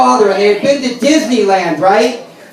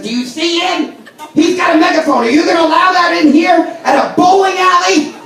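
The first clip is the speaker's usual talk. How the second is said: higher, outraged complaint to the desk; woman complaining to the front desk about the megaphone; tattling lady; 5 semitones higher than usual. Retold or imitated. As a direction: whine like an offended bowler running to the manager. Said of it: "ran right up to the front desk and said".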